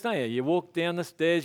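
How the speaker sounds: ordinary talk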